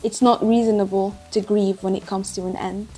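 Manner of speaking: soft, even audiobook reading; solemn story narration. Acted. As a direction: read the preface quietly and gravely. Reads the book's preface aloud.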